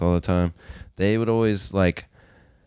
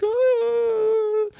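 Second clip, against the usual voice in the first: high squealing cry of pain, retold; mock the wife's yelp of pain, very high. Retelling how the wife yelped after the firework hit her, high pained cry.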